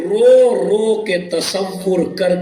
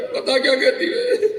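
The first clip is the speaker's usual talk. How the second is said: crying. Real, not acted. Preacher breaks into weeping.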